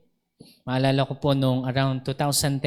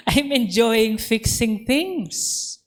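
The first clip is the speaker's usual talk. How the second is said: delighted discovery; 7 semitones higher, much slower than usual. Retold or imitated.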